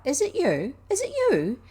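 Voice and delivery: high, loud delighted cry; thrilled girl calling to the robin; cried out. Acted. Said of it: "she cried out".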